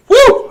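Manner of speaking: high, loud celebratory whoop. Real, not acted. Streamer cheering after finishing a beer, loud whoop. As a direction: let out a big cheer.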